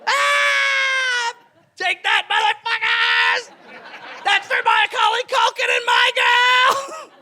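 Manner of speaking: shrill panicked shrieking, way up high; screaming like a little girl. Pretend. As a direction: scream like a scared little girl while fleeing. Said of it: "run away screaming like a little girl".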